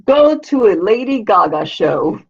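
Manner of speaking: usual speaking voice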